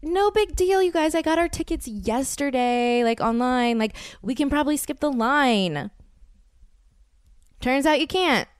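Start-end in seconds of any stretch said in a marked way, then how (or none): 0.0-5.9 much higher, breezy reassurance to friends; breezy confidence. Retold or imitated. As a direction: sound sure of yourself. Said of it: "So I'm like".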